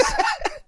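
short squealing laugh; quick high burst of laughter. Real, not acted. Reacts to dark joke.

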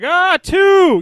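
high, loud shout of the meal number; yelling order. Pretend. Host pitching a speakerphone drive-thru order, loud high yell. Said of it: "So it's like".

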